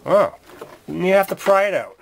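ordinary delivery

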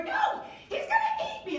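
very high, laughing scared shriek; hammed-up terror. Shown as a demonstration. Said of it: "and you go".

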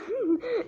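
sudden squeaky laugh breaking the sentence; high burst of laughter. Real, not acted. Laughing at the chatbot in the middle of a line. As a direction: let a high giggle burst out.

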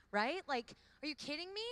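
high, loud, swooning exclamation; excited gush. Real, not acted. Speaker gushing to the women about a man's vow, excited and loud. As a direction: squeal with delight at the thought. Gushing over romantic man.

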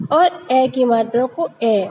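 ordinary delivery

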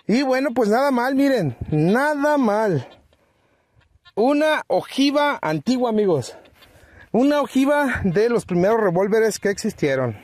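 pleased treasure-hunter glee; thrilled discovery. Real, not acted. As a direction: show off the find with real excitement. Shows the bullet he just dug.

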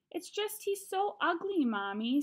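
put-on high little-boy complaint; childish protest, pitched high; little kid brother. A narrator's reading. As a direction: plead to mommy in a small child's voice. Little brother voiced in story.

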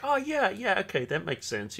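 mock dawning understanding; sudden realization. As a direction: act out the penny dropping. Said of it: "you're like".

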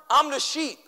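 normal talking voice

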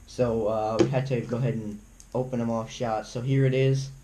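usual speaking voice

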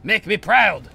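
loud, high battle send-off; commanding call. Pretend. Sorcerer sending his apprentice to fight, commanding call. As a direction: call it out to your champion.

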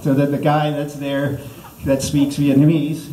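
normal talking voice

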